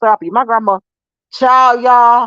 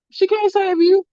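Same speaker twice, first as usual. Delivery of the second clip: higher, gloating sing-song; sing-song taunt; 11 semitones higher than usual. Retold or imitated. Mom taunts daughter behind grandma, high and gloating. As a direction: rub it in, pitched up high. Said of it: "I'd be like".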